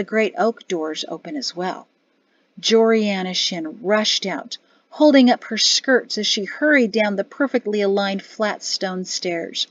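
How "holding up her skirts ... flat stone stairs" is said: ordinary delivery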